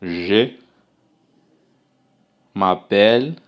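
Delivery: slow, careful French Je m'appelle with a rising end; teacher models "m'appelle" as mah-PELL; French model phrase. Shown as a demonstration. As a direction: spell out "Je" then "m'appelle" with a long pause. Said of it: "você vai dizer assim".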